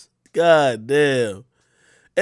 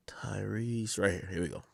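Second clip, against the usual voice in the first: quiet murmur; 6 semitones lower, 16 dB quieter than usual. Real, not acted. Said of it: "host finding clip".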